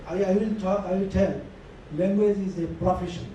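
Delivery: ordinary talk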